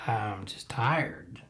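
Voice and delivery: barely voiced, trailing off in fatigue; weary murmur. Real, not acted. Host repeats to listeners he is worn out, in a slow soft murmur. Weary podcaster sighing about covid.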